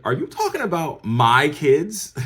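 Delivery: loud, high, slow incredulous demand; outraged question. Retold or imitated. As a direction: act out an outraged mother confronting someone. Mom's outraged question retold.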